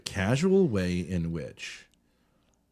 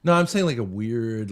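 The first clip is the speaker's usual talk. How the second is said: ordinary delivery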